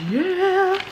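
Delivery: high drawn-out squeal of glee; giddy high-pitched whoop; excited cheer. Real, not acted. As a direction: stretch it into a high, rising yay. Cheering as she opens the package.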